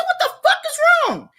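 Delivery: very high-pitched, rapid mock outburst; alarmed retort. Friend on the phone demanding what happened, alarmed. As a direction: squeal the question in exaggerated disbelief. Acted alarmed reply to frightened caller.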